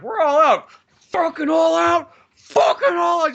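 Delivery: high, loud mock panic; freaking out. Playing Alan out of T-Rex pee, freaking out. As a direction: shout it in a hammed-up frenzy. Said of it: "Just, like, freaking out".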